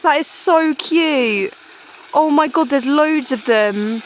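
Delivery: slow, loud delighted gushing; excited. Real, not acted. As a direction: gush over the waterfalls. Thrilled by little waterfalls.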